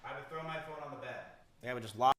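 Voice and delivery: toothbrush in mouth, mumbled words; while brushing teeth. Shown as a demonstration. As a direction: speak mid-brushing, mouth full. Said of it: "I will throw you and show you an example".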